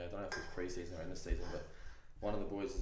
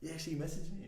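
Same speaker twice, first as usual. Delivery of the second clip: mock smug teammate; 12 semitones higher than usual. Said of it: "and he's going".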